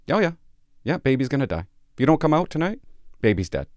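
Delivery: hammed-up urgent warning; blunt mock warning. Shown as a demonstration. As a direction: hammer it home to the caseworker. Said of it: "this is how you get M C F out".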